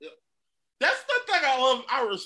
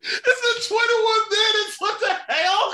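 mock outrage; 11 semitones higher, 5 dB louder, much slower than usual.

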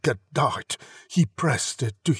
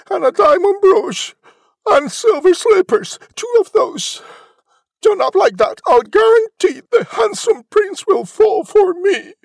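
put-on very high, loud girlish demands; demanding Cinderella; 9 dB louder than usual. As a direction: reel off demands in a high, pushy voice. Cinderella making demands in story.

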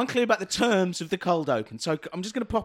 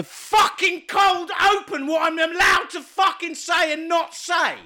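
raised voice; 9 semitones higher than usual. Pretend. Employee yelling at HR boss, shouting demands. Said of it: "I'm sorry I raised my voice".

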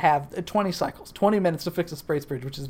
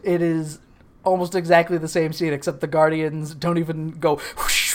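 ordinary talk

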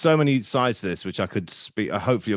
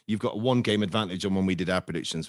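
normal talking voice

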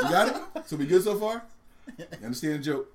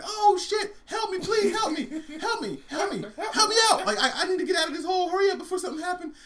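high, frantic elephant cry for help; hammed-up squealing panic; panicked screaming; 4 dB louder than usual. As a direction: scream for your friend from the pit. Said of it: "He starts screaming for his mouse friend".

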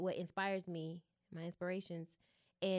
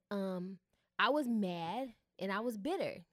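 ordinary talk, nothing marked

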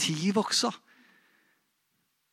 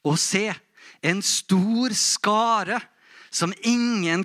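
slow, raised, measured proclamation; solemn scripture reading; 4 semitones higher, 3 dB louder, slower than usual. Acted. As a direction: read the verse out slowly and with weight. (høytidelig, langsom bibellesning)

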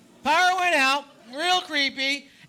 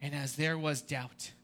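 hushed sermon; 9 semitones lower, 6 dB quieter than usual. Acted. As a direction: draw them into the silence of the tomb. Hushed buildup toward Sunday.

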